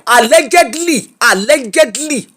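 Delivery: allegedly said pointedly, with a knowing lift; stress on allegedly. Shown as a demonstration. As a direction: lean hard on allegedly. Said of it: "emphasis on allegedly".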